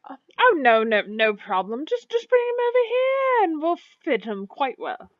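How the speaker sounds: high, swooping, eager reassurance; obliging tailor. Acted. Tailor offering to fit the customer's companion, obliging. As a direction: wave away the worry as an eager tailor. Game master voices the tailor.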